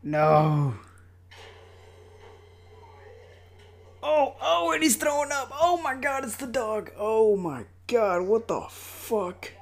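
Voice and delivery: high, loud shocked cries at the screen; stunned and disgusted, loud and high; horrified outburst. Real, not acted. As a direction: recoil in real horror, voice jumping up high. Dog found dead in dryer.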